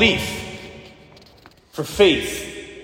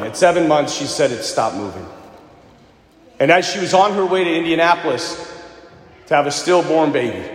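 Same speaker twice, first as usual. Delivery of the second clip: ordinary talk, nothing marked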